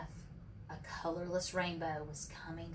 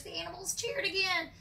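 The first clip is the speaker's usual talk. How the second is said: usual speaking voice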